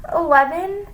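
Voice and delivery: high, unsure answer; guessing. A narrator's reading. Pupil guessing a sum under threat, unsure. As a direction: answer as a tentative question. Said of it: "she guessed".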